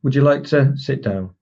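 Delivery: exaggerated embedded command, "sit down" set apart and falling; falling command with pause. Shown as a demonstration. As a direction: pause before "sit down", then pitch goes down. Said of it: "if you put the pause in there".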